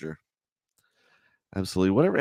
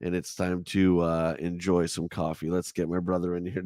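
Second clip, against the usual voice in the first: ordinary delivery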